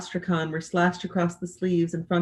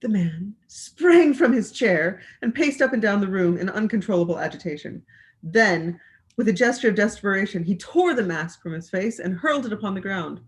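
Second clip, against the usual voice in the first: ordinary delivery, nothing marked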